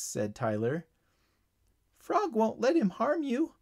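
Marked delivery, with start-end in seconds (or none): 2.0-3.5 very high little bug voice; insistent ladybug. A narrator's reading. Ladybug insists the frog means no harm, high insistent voice. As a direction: press the point in a tiny high voice. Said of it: "insisted the ladybug".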